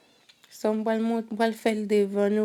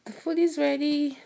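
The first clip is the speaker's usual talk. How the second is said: loud call, pitched up high; yelling across the room; 6 semitones higher, 4 dB louder than usual. Real, not acted. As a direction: call out to the whole house. Announcing food ready to household.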